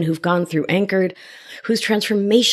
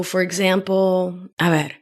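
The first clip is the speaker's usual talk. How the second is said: normal talking voice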